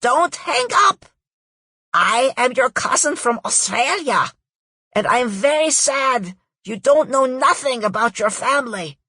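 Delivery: put-on a raspy cousin with a thick German-Aussie accent begs loudly not to hang up; Ziggy's raspy hybrid accent. A narrator's reading. Cousin on the phone protests to the narrator, loud raspy German-Aussie accent. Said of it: "raspy male voice speaking in a thick hybrid accent".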